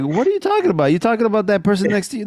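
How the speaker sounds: a confused tough guy asking who you mean; baffled thug. Retold or imitated. Thug baffled by crazy act.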